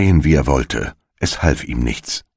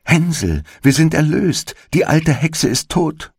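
very high, jubilant cry without shouting; excited calling out; 13 semitones higher than usual. A narrator's reading. Sister frees her brother from the stall, calling out excitedly. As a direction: lift the whole call high with relief. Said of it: "öffnete sein Ställchen und rief".

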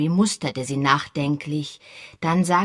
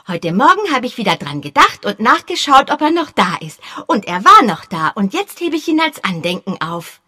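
put-on high, loud, quick boyish voice; a keen young boy, pitched up and fast; eager boy; 6 semitones higher, 6 dB louder, faster than usual. A narrator's reading. Boy Peter pestering Sir Henry, high eager voice.

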